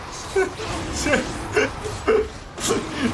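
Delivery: high wheezing laugh at his own slip; helpless laughter. Real, not acted. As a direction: crack up over a tongue slip.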